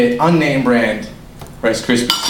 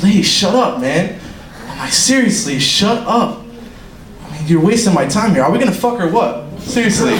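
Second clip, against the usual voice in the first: raised-pitch, impatient retort; mock exasperation; 6 semitones higher than usual. Man to chatty subway lady, mock exasperation. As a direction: cut her off, comically fed up.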